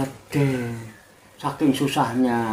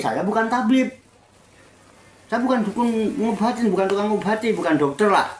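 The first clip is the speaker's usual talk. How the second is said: higher, dismissive voice of an annoyed woman; refusing widow; 7 semitones higher than usual. Acted. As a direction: brush the stranger off firmly. (janda menolak tamu, nada tinggi)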